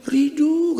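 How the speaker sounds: "Ridu" called out high, vowels of Rudi swapped; mispronounced name. Retold or imitated. Grandparent calling the grandchild, mispronouncing Rudi as "Ridu". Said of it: "Nggak pandai dia panggil Rudi".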